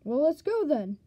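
normal talking voice

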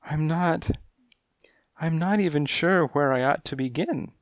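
high, coy feline voice; high kittenish client voice; coy cat lady. A narrator's reading. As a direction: purr it like a kittenish femme fatale.

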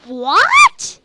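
high, loud shocked what; loud disbelieving what. Real, not acted. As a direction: blurt it out in shock.

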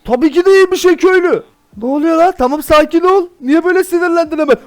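loud excited bickering; heated shouting. Real, not acted. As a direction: yell to calm down an angry villager. Arguing with angry villager.

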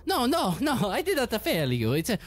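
a cornered lackey begging for his life; high ethnic voice. Acted. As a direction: Fausti protesting to his master in a shrill panic. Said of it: "Fausti go".

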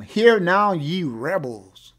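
loud frustrated outburst, slow on ye rebels; frustrated Moses. Retold or imitated. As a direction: bark it at the crowd like a fed-up leader. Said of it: "Moses is probably a little frustrated".

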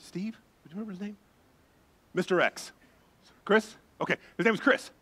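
ordinary delivery

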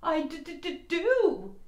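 slow, stammering with cold; shivering. Acted. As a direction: teeth chattering on d.

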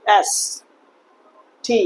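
ordinary talk, nothing marked

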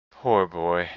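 subdued, sorrowful murmur of sympathy; pity. A narrator's reading. Scrooge pitying his former self, subdued and sad. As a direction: let it sink, full of pity. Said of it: "said in pity for his former self".

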